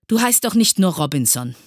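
ordinary delivery

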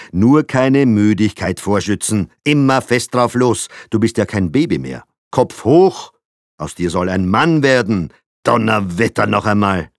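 loud, commanding order to the son; strict father commanding loudly; drill sergeant. A narrator's reading. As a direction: bark at the boy to toughen up.

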